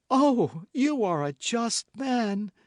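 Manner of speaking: mock-ecstatic plea; fervent cry. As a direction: throw herself at him in gratitude. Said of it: "cried Milady".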